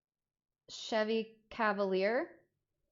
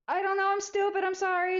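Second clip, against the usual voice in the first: much higher, whiny, pleading; whiny apology; 12 semitones higher, 5 dB louder than usual. Retold or imitated. Kid caught by mom, high whiny apology. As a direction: whimper out the apology. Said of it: "I was like".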